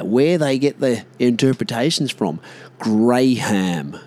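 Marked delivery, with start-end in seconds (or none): 2.8-4.0 "Graham" as two full syllables, "Gray-ham"; spelling pronunciation. Shown as a demonstration. Ranting about how the name is written, spelling pronunciation. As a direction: sound out the h in "Graham". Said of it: "the name Graham".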